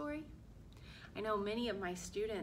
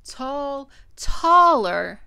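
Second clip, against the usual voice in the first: put-on expressive picture-book narration for children; story read-aloud; 17 dB louder than usual. A narrator's reading. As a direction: read it to young children, clearly projected.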